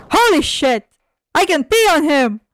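loud, delighted outburst; amazed excitement. Real, not acted. Player discovers a new attack, loud excited amazement. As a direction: burst out in gleeful disbelief.

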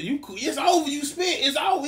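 high gloating call; triumphant. Real, not acted. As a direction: crow about winning. Triumphant teasing.

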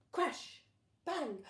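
high, loud burst of sound words; sudden loud crash. A narrator's reading. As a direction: shock the listener with the crash. Sudden noise in story.

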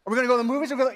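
insistent questions, each rising at the end; nagging. Retold or imitated. Someone badgering over and over, nagging. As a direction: pester with the same question repeatedly. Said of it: "say something over and over".

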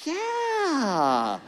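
high, strained fake yeah; forced mock cry of joy. Husband faking joy over the blanket, strained and high. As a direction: fake your excitement with a pained cry. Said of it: "I was like".

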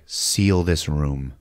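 low, slow grave command; grave order. Retold or imitated. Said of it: "she's like".

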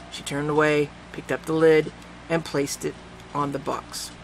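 ordinary delivery, nothing marked

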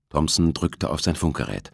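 ordinary delivery

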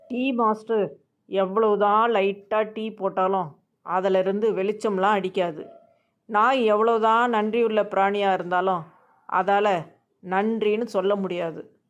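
ordinary talk

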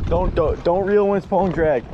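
loud urgent call across water; shouting. Real, not acted. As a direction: yell it out to your buddy. Yelling to friend on water.

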